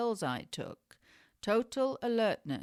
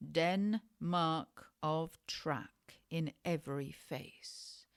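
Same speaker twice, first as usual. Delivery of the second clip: usual speaking voice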